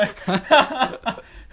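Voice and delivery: high laughing outburst; loud burst of laughter. Real, not acted. Laughs after translation.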